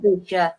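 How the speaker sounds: Derbyshire with a weak -shuh ending; British pronunciation. Shown as a demonstration. Guest answering how she says it, Derbyshire as DAH-bi-shuh. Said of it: "how do you say it".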